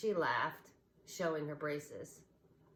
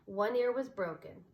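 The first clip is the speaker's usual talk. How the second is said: ordinary delivery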